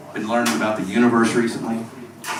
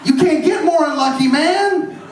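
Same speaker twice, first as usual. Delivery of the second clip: exasperated shout; 14 semitones higher than usual. Acted. Comedian ranting about living in Bratislava, exasperated shout. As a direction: cry out in exasperation at rotten luck.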